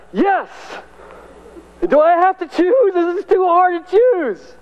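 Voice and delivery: high, gleeful Paul who refuses to choose; delighted Paul. Retold or imitated. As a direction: act Paul thrilled by both options. Paul answers live or die.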